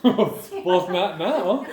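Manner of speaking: talking through loud laughter; laughing. Real, not acted. Family laughing at gymnastics news.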